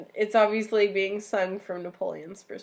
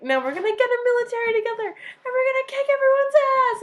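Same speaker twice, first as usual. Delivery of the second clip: much higher, louder, fast rally; mock rallying call; 7 dB louder than usual. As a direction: fire up the troops, hammed-up. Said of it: "he's like".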